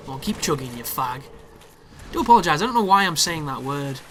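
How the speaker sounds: ordinary talk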